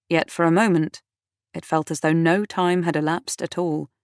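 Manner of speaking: usual speaking voice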